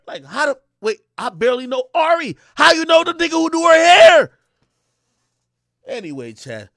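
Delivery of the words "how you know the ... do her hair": high, loud outburst; incredulous retort. Retold or imitated. Man questioning his date's connections, incredulous yell. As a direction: yell it back in disbelief.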